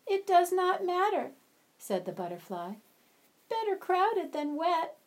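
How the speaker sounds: high, light pleading butterfly voice; a small soaked butterfly begging to come in; wet butterfly. Acted. Wet butterfly begs the ant for shelter, high and pleading.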